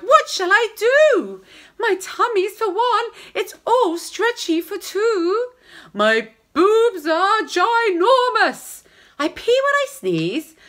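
high, loud mock wail; hammed-up desperate mum-to-be; wailing pregnant woman. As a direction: whine it up high, overwhelmed. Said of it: "The pregnant young lady cried".

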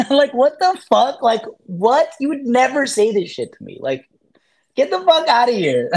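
high, incredulous scoff at a stranger; incredulous mock outrage. Reading a hater's profile, incredulous scoff.

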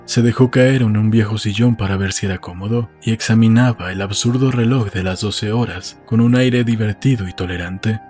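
usual speaking voice

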